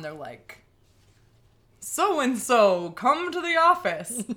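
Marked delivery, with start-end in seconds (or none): 1.8-4.2 loud intercom announcement; over the intercom. Retold or imitated. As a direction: call it out like a school PA. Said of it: "over the intercom".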